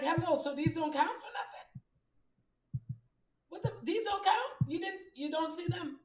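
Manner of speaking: high, loud, slow mock outrage; indignant shout. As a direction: call out loud and high, offended. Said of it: "I used to have to turn around and be like".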